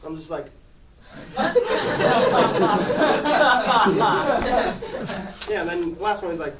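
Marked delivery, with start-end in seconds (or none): 0.5-5.4 big loud burst of laughter. Real, not acted. Recalling the doctor's blind-eye warning, loud laughing. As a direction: crack up loudly.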